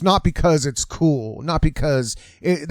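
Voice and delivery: ordinary delivery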